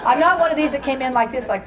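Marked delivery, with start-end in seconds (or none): none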